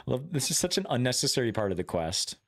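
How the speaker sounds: usual speaking voice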